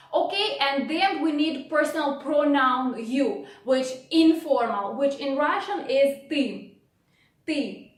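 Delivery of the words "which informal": normal talking voice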